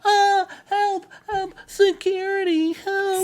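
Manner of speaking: high-pitched mock screaming for help; hammed-up falsetto cry for help; panicked secretary. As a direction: shriek like a frightened secretary calling security. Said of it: "That's what she sounded like?".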